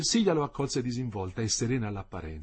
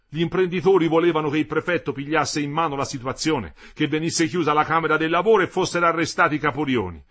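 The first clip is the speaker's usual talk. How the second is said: louder, higher indignant telling; bitter outburst; 4 semitones higher, 7 dB louder than usual. Acted. Metello tells his wife about the strikebreakers. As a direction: let the anger rise as you explain.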